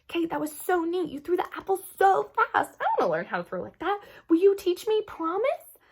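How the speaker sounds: high, eager gushing; excited. Acted. Gabby gushing to Kate about her throw. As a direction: bubble over with admiration.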